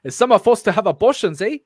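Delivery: loud attempted South African accent. Reader struggling through a script, South African accent. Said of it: "work on my South African accent".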